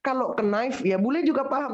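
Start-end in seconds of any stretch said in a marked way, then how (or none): 0.4-0.8 "knife" as "kuh-naif"; raised "kuh-naif", K sounded in knife; wrong knife. Shown as a demonstration. Teacher contrasting what foreigners grasp, knife as "kuh-naif". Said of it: "tapi nggak ada bule bilang".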